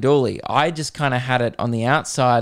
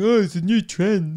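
mock vacant voice, higher; dim trend-follower; 6 semitones higher than usual. Comedian mocks dim TikTok fans in vacant voice. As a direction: airhead parroting a trend.

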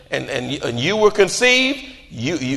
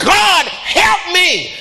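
slow, high shouted plea for help; crying out to God; 9 semitones higher, much slower than usual. Acted. I'm still crying out to God.

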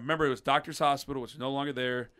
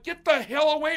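high, loud outraged yell; hammed-up outraged shout; mock yell; 14 semitones higher, 5 dB louder than usual. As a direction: shove him off with your voice. Yelling at nurse.

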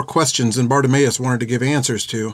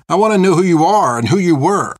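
higher, insistent challenge; defiant demand; 6 semitones higher, 5 dB louder than usual. Acted. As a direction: push back, pitched up and insistent. Girl defiantly demands the truth.

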